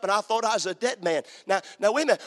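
ordinary delivery

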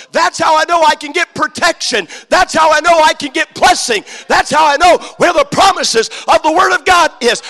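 loud, high, driving preacher's call; full-force preaching. Acted. Preacher lifting up the Bible to the church, loud. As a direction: preach it out to the congregation.

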